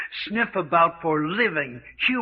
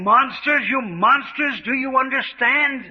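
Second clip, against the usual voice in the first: furious outcry; 6 semitones higher than usual. Acted. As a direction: hurl the word monsters at them. Captive shouts at his captors.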